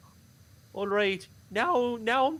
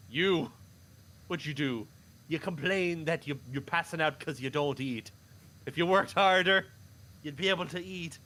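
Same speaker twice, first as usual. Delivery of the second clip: put-on stern old father; 4 semitones lower than usual. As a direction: lower gruff pa scolding his lad. Father berates the lazy boy.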